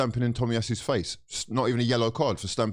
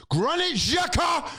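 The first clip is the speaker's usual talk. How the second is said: high, slow, drawn-out call of the name; slower than usual. Real, not acted. Angry fan calling out a player, slow high call. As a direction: drag the name out like a summons.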